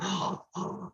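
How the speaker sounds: sharp gasping breath in; gasp for air. Shown as a demonstration. Teacher demonstrating a drowning person surfacing, gasping.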